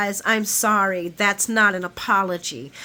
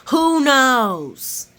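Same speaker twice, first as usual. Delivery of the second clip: louder, higher, drawn-out imitation; mock dramatic query; 4 semitones higher, 5 dB louder than usual. Repeating Carly's question to Nina, mock dramatic. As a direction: belt out the jab with theatrical relish. Replaying Carly's jab with relish.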